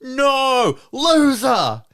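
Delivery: loud, high jeering yell; 1 mock yell. Parents jeering their son at the finish, loud yell. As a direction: yell it at your own son. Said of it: "the Gribbles both go".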